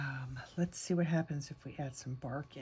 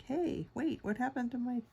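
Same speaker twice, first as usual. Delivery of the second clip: surprised; 7 semitones higher than usual. Retold or imitated. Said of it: "it's like".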